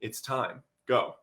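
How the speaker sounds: soft voice of God. Retold or imitated. God repeating himself softly. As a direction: say it calmly, barely above a breath. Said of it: "he repeated himself".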